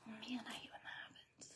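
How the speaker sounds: breathy unvoiced aside; whisper. Real, not acted. Vlogger confiding to viewers in a whisper.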